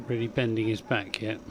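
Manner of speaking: ordinary delivery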